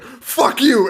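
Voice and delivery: high-pitched imitation of an angry outburst; mock angry. Bandmate snapping back at singer before spitting, mock angry. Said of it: "[name] gets mad at [name]".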